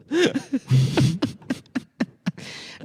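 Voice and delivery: high, long wheezing laugh; helpless laughter. Real, not acted. Comic cracking up at his own bit, real high laughter. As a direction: crack up and keep going.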